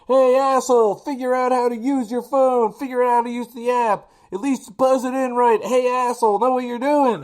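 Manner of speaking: high, loud furious yell; angry yelling neighbor. Retold or imitated. Neighbor yelling at the delivery driver from his window, furious. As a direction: bark it down from the window like an enraged neighbor. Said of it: "proceeds to yell at me".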